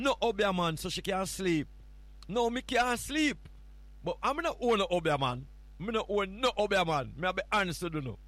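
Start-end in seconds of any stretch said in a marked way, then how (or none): none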